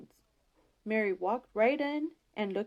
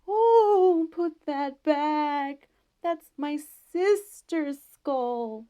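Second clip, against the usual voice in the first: high, very slow wailing ghost pulling out every word; ghost's voice; 6 semitones higher, 6 dB louder, much slower than usual. A narrator's reading. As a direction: moan it like a hidden ghost, loud and drawn out. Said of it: "that old fellow hiding behind the door started in".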